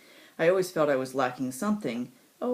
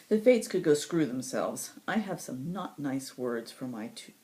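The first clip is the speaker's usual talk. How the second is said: plain reading voice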